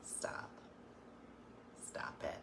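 whispered, very soft reproof; hushed mock scolding. Telling mom to quit flirting, hushed mock scolding. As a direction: tell her off under your breath.

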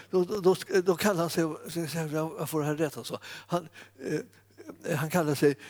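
ordinary delivery

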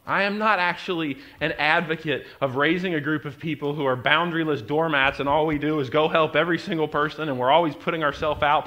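ordinary talk

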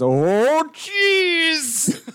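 laughing exclaim. Acted.

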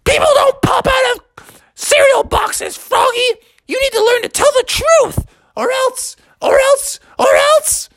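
very high, exasperated mother; scolding mom. Acted. Mom warning the boy about lying, furious. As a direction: lecture shrilly, building repeated threats. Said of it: "And she's like".

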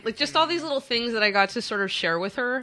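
usual speaking voice

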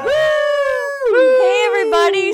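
loud cheery greeting; excited shout. Real, not acted. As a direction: burst in yelling hello. Guest bursts in loudly.

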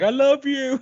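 high, hammed-up lovesick voice; late, overdone confession of love; mock love declaration. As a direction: say it in an exaggerated high whine. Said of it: "he's like".

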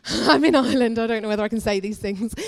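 laughing through the words; amused aside. Real, not acted. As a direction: break into a laughing aside to the audience. Joking aside about rude word.

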